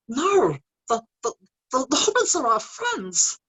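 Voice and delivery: high-pitched, halting creature voice; Gollum. Shown as a demonstration. Doing a quick impression on a fellow actor's request, high Gollum voice. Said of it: "give us a quick Gollum".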